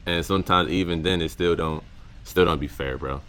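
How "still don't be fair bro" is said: normal talking voice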